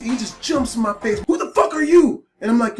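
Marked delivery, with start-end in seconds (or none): 1.3-2.2 loud mock demand from the angry dad. Angry dad confronting the stranger, loud mock demand. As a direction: bark it like a furious father.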